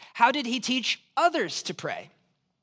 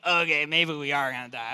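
resigned dread; 8 semitones lower than usual. Retold or imitated. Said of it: "I was like".

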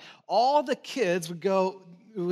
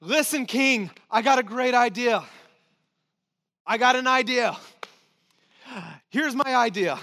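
a lightly voiced eager schemer, louder and higher; scheming Haman; 4 semitones higher, 4 dB louder than usual. Haman eagerly pitching his plan to the king, mildly hammed-up. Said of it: "and he's like".

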